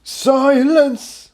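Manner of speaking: high, sharp call for silence; commanding shout. Acted. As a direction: silence the crowd. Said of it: "Starburst shouted".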